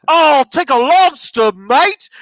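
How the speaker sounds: mock Australian accent. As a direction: order like a fake Aussie. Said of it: "in Australian accents".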